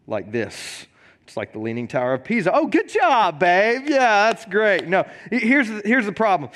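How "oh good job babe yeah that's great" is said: put-on high, sing-song praise for a toddler; fake cheerful praise. Dad praising his toddler's leaning tower, high and sing-song.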